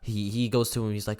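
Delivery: usual speaking voice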